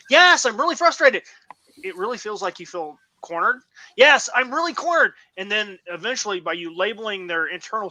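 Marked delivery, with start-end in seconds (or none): 0.1-1.2 acted frustrated cry, high and loud; frustrated outburst. Frustrated person answering someone who labels their emotion, loud high outburst. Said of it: "then you start saying". 4.0-5.1 acted high, loud cry of agreement; cornered outburst. Cornered person answering someone who labels their feeling, loud high outburst. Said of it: "then you start saying".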